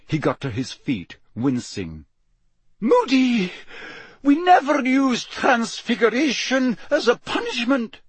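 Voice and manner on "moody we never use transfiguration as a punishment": very high, dismayed voice; weakly protesting. A narrator's reading. McGonagall reproaching Moody after the transfiguration, weakly protesting. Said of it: "said Professor McGonagall weakly".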